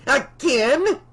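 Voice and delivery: loud, high outraged shout. Acted. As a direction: yell it in disbelief. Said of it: "she shouted".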